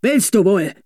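gruff threat to a stubborn horse; threatening. A narrator's reading. Beer carter threatening his horse, a mild gruff threat. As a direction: warn the horse, rough and short. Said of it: "drohte der Bierkutscher".

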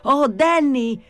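high-pitched alarmed call. A narrator's reading. Jesus Maria warning Danny of the fire, calling out. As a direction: raise the alarm to someone indoors. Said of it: "gridò Gesù Maria".

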